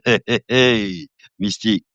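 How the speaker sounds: normal talking voice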